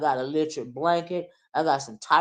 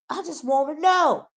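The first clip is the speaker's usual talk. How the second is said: insistent; 10 semitones higher than usual. Real, not acted. High question leading into song.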